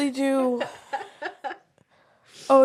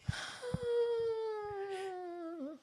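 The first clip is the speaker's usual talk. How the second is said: shaky sigh; 9 semitones higher, 18 dB quieter than usual. Real, not acted. Moved close to tears.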